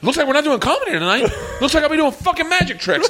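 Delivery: high-pitched sarcastic griping to a crowd; mock exasperated announcement. Retells Louis griping on stage.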